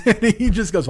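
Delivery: usual speaking voice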